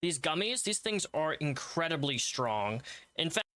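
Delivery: hammed-up sponsor pitch; upbeat salesman voice; ad read.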